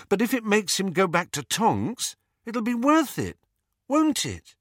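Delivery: high, anxious plea for agreement; pleading. A narrator's reading. Harry hoping Lupin returns to Tonks, pleading. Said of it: "could not keep the plea out of his voice".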